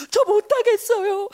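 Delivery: high, drawn-out tearful wail; imitated crybaby refusal; mock crying. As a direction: whine through pretend sobs. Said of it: "하루 종일 울었던 적도 있다".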